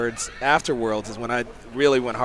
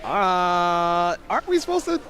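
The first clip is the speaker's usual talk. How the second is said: retold hesitant question; 4 semitones higher than usual. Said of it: "And I was like".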